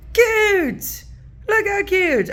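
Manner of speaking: high delighted squeal; squealing cute. Real, not acted. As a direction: gush over it, high and bright. Gushing over cute ephemera.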